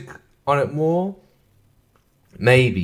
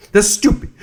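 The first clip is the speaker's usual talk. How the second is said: "That's stupid" jumps much higher and louder, with a rising end; mock high voice; 8 semitones higher, 5 dB louder than usual. Shown as a demonstration.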